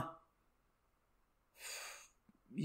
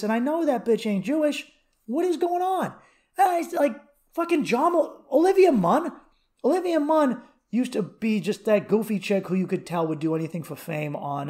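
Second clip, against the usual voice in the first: normal talking voice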